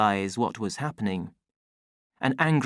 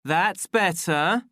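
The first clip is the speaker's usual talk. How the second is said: smug official; 7 semitones higher, 4 dB louder, slower than usual. A narrator's reading. Official satisfied after threat.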